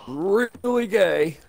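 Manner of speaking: slow high teasing; teasing drawl. Pretend. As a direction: drag it out mockingly.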